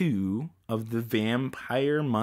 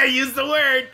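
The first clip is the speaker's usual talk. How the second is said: laughing shout; 10 semitones higher than usual. Real, not acted. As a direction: yell it out while laughing.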